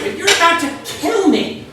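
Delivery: high, loud exasperated cry; a comedian yelping at his captor that he's about to be killed; losing it. Retold or imitated. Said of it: "And I lose it".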